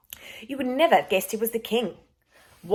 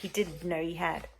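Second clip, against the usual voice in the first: usual speaking voice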